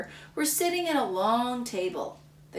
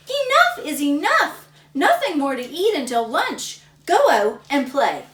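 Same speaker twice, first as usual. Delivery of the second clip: high, loud scolding; yelling mom; 6 semitones higher, 9 dB louder than usual. Acted. Mom scolding Samuel to go out and play, loud. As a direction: scold him firmly and loud. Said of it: "yelled his mom".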